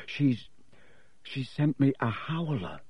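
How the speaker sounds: a faint, dazed voice. A narrator's reading. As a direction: barely get the words out. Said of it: "said Ron faintly".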